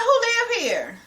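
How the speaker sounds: high, exasperated questions at the family; retold outrage. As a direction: squeal it up high like walking into a mess. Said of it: "I'm like".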